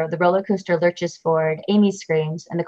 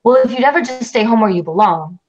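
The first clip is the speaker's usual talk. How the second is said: angry; 5 semitones higher, 7 dB louder than usual. Acted. Angry husband snaps at wife.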